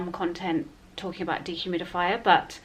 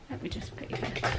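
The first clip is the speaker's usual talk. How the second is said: whispering; 12 dB quieter than usual. Real, not acted. Settling the baby down.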